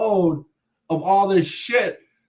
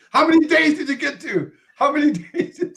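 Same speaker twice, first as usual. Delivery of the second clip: high, laughing question; 12 semitones higher, 3 dB louder than usual. Real, not acted. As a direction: ask it through real laughter. Listener laughing at the story.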